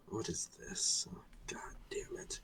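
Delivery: whispered, weary grumble; barely audible fed-up mutter; exasperated muttering. Acted. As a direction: curse softly under your breath. Character alone, exasperated at letter.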